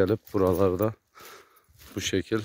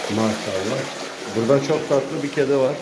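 cooing; 5 semitones higher than usual. Real, not acted. Cooing over a cute cat.

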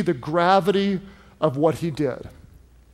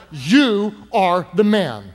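slow, emphatic accusation, louder; pointed accusation; 5 dB louder, slower than usual. Retold or imitated. Prophet Nathan confronts King David with his sin, pointed accusation. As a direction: drive each word home slowly and firmly.

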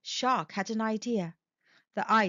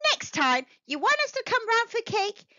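relieved octopus; 9 semitones higher, 3 dB louder than usual. Acted. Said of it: "said Octopus".

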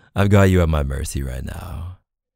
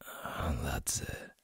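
whispered praise; 3 semitones lower, 18 dB quieter, slower than usual. Acted. Soft praise while tying hands.

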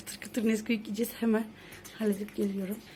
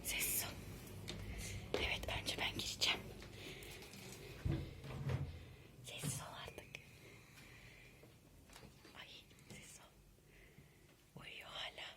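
whispering; 4 semitones higher, 16 dB quieter than usual. Real, not acted. Sneaking near sleeping person.

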